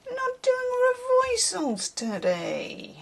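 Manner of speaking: high, slow, hammed-up New York accent; terrible New York accent. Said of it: "That's my terrible New York accent".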